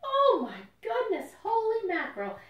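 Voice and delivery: high, loud gasp of wonder; amazed exclamation. Shown as a demonstration. Storyteller acting out amazement for the children, high and loud. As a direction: sound truly astonished. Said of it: "It makes you say".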